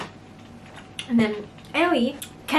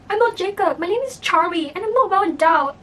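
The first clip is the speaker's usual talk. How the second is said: put-on frightened boy; 8 semitones higher, 5 dB louder than usual. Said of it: "that was when he said".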